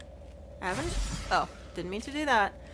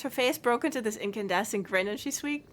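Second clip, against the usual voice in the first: ordinary talk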